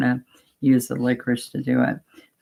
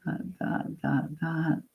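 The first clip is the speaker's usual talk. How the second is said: whispered counting; 2 semitones higher, 10 dB quieter, slower than usual. Shown as a demonstration. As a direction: whisper "Dot, dot" softly under the breath. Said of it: "you're gonna go dot".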